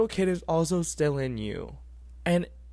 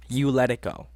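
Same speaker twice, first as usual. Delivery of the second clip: normal talking voice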